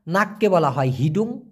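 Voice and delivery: normal talking voice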